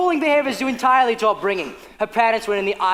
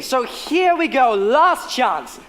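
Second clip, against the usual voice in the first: ordinary delivery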